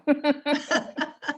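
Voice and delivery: a real, high burst of laughter. Real, not acted. Guest laughs at her own birth certificate story, high laugh. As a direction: laugh out at the oddity of it.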